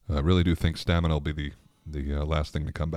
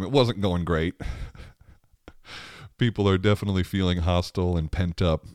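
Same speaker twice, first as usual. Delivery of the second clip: ordinary talk, nothing marked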